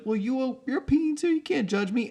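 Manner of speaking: very high mock voice; squeaky defensive retort. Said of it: "And it's like".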